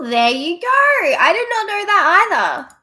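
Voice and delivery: loud, high-pitched amazement; surprised. Real, not acted. Streamer learning something new from the chat, surprised. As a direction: exclaim it, pitch swooping up high.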